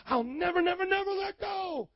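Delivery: a mock believer's vow that swells to a high shout; building vow.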